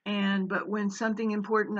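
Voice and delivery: usual speaking voice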